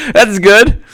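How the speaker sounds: high giggly delight; amused high giggle; laughing. Real, not acted. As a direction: laugh through the words. Laughing at the clip.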